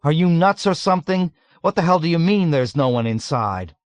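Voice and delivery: high, loud disbelief; dreading shout. A narrator's reading. Yossarian shocked by crazed friend, shouting with dread. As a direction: challenge him loudly in dread. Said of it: "he shouted with dread".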